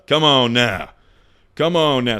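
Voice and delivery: loud, fired-up come on now; hyped up. Real, not acted. Streamer hyping himself to chat, loud and fired up.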